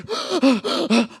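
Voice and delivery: sharp gasp of fright; gasp, jolted awake; mock frightened gasp; startled gasp. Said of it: "I was like".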